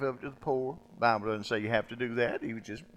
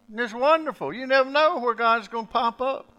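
higher, louder preacher's proclamation; fiery preaching; 12 semitones higher, 10 dB louder than usual. Acted. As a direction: preach it out to the congregation. Preaching at full force.

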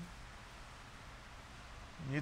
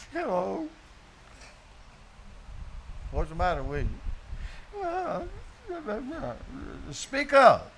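higher, lightly voiced frail woman; sick woman's voice; 4 semitones higher than usual. Preacher voicing Bootsy in her hospital bed, lightly tearful. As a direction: play her small and tearful.

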